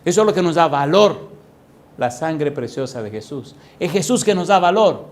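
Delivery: sermon rising to forceful declarations; emphatic preaching. Real, not acted. Preacher telling believers what gives them value, emphatic preaching. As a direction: proclaim it, punching each refrain.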